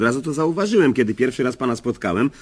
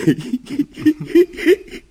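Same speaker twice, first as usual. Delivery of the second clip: self-amused laugh; 14 semitones higher than usual. Real, not acted. Laughing at his own joke.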